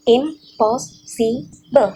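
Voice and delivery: very slow, syllable by syllable; syllables spelled out. Shown as a demonstration. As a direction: stretch impossible into im-pos-si-ble. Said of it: "And per syllable".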